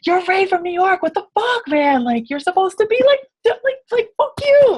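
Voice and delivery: high-pitched mock outburst; shrill-pitched, hammed-up hazing; mock berating. Retold coworkers hazing her husband.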